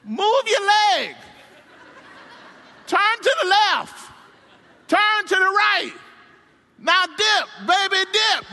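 high, loud coaching calls, laughing; father coaching son off the bars; instructing dad. Retold or imitated. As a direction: bark directions at the kid. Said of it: "tries to instruct him out".

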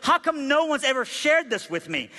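high-pitched, pleading disbelief; incredulous question. Retold or imitated. Bus passenger to the evangelist after hearing the gospel, incredulous. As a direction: ask it as if astonished nobody told you. Convert's astonished question, acted out.

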